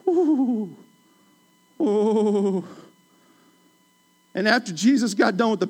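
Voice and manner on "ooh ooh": preacher's ooh. Real, not acted. Preacher reacting to a point.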